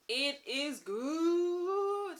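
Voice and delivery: it is good said very slowly, high and loud, good stretched; drawn-out praise. Real, not acted. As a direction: savour every word of it is good. Gushing about the book.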